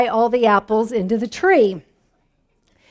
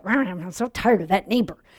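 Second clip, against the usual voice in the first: annoyed grumble; 5 semitones lower, 7 dB quieter than usual. Pretend.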